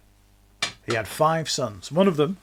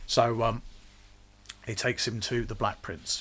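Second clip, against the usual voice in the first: ordinary talk, nothing marked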